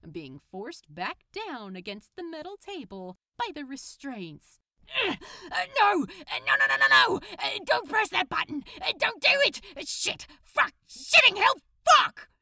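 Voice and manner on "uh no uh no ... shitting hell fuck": loud, high frantic yelling; panicked shouting. Acted. Crowley begging Aziraphale not to press, panicked shout. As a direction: scream in rising panic.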